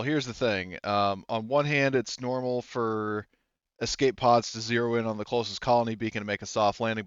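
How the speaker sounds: normal talking voice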